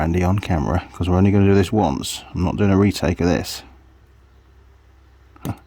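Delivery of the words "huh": ordinary talk, nothing marked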